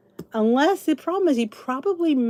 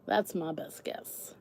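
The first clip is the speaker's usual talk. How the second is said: ordinary talk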